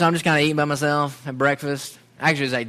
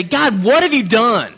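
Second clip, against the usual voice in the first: raised, slow, desperate outcry at God; loud cry to God; 7 semitones higher, 7 dB louder, slower than usual. Retold or imitated. Alone on a village path, crying out loud to God. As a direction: hurl the question upward, slowly. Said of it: "I say literally out loud".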